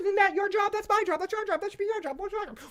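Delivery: hammed-up very high squeaky parody; squeaky mock bickering. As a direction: chirp the squabble like cartoon birds. Mocking bickering council.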